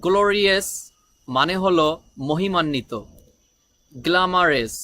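ordinary delivery

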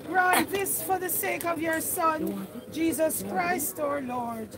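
fervent prayer. Acted. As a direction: lift the prayer up with full voice. Prays aloud over the grave.

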